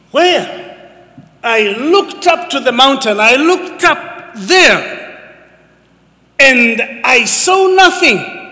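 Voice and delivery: high, slow, searching cry; anxious psalmist. Acted. The psalmist crying out for help, high and slow. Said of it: "the psalmist asks the question for us".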